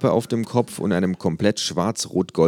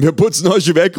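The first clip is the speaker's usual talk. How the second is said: loud, higher fan's yell; shouting in; 12 semitones higher, 10 dB louder than usual. A narrator's reading. Fan yelling over the kangaroo, loud. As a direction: cut in with a shout. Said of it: "ruft der Patient dazwischen".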